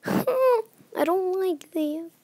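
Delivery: high, slow half-laughing whimper; nervous near-crying complaint; genuinely frightened, laughing through it; scared whimper. Real, not acted.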